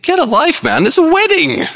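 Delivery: very high, indignant cry; exasperated outburst. Acted. Wedding guest reacting to gloomy minister, exasperated. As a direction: snap at him in comic exasperation.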